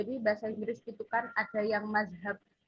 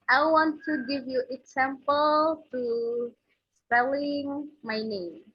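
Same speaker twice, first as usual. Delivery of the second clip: slow teacher English; 4 semitones higher, 5 dB louder, slower than usual. Shown as a demonstration. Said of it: "Oke, aku mau ngasih contoh nih".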